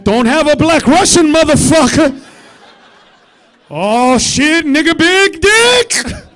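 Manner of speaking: loud, laughing shouted warnings; rowdy bar shouts. Pretend. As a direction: yell it like a drunk patron.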